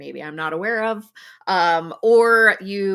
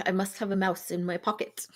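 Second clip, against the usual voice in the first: ordinary delivery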